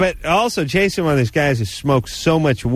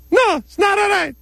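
ferret voice. Pretend. Vagina puppet argues with woman.

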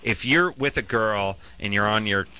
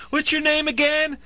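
much higher, clueless fan voice; mock fan. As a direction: ask like a fan who forgot. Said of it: "And they'll go".

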